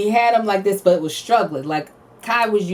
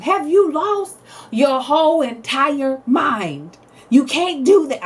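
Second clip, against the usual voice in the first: imagined mom yell; 8 semitones higher, slower than usual. Retold or imitated. Mom showing how she would yell at Kai, a mock scolding yell. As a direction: blow up at the kid in mock fury. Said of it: "I could have yelled at Kai".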